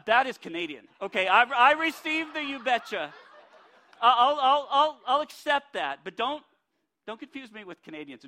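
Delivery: loud, laughing mock indignation; laughing protest. Preacher retelling his airport reply to the lady, loud laughing protest. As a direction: protest loudly through laughter, half joking. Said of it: "And I was like".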